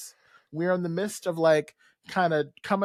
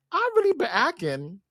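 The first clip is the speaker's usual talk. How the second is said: boastful Charles; 7 semitones higher, 3 dB louder than usual. Retold or imitated. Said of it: "Charles is like".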